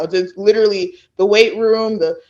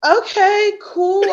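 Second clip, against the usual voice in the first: retold okay; 13 semitones higher, slower than usual. Her own retold reply.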